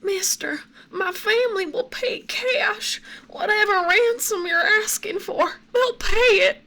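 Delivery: high, tearful Southern plea, whispered then crying; frantic Southern woman. Acted. Captive Southern woman pleading with her kidnapper, high and tearful. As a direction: beg in a frantic American Southern accent, voice breaking. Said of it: "you're a Southern woman... scared, frantic".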